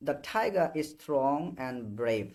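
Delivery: each English word stretched out very slowly; slow dictation. Shown as a demonstration. Teacher dictating the example to students very slowly. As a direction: spell the sentence out for slow learners.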